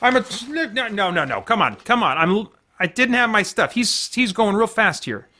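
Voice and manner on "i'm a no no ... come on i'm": ordinary delivery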